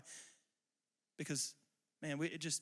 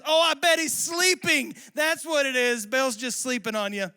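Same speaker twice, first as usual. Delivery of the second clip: loud, high, slow sarcastic jab; goading taunt; 9 semitones higher, 13 dB louder than usual. Retold or imitated. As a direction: rub it in that their god is asleep. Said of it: "goating it the whole time".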